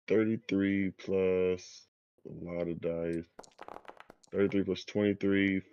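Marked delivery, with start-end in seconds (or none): 0.1-1.9 drawn out while counting up; slow, thinking aloud. Real, not acted. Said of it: "working out the sum aloud". 4.3-5.6 rattled off quickly; fast, got it now. Real, not acted. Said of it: "working out the sum aloud".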